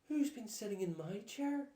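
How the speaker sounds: a hint of an upset mother bear; Mummy Bear crying out. A narrator's reading. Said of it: "cried Mummy Bear".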